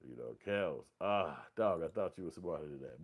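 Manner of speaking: normal talking voice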